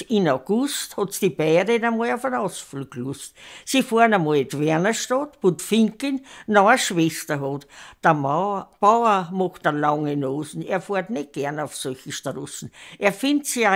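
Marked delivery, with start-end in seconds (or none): none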